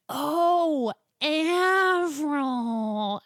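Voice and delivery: loud, high "Oh" of recognition; drawn-out mock epiphany, loud; dawning realisation. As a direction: finally get it, exclaiming. Sudden realisation of the name, retold.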